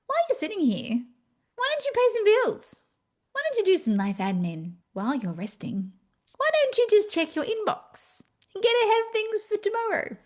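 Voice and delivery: high, pestering inner voice; nagging little voice. Retold or imitated. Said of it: "This little voice goes".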